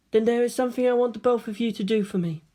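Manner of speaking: put-on an even, gentle young woman; Rei's calm voice. A narrator's reading. Rei speaking calmly.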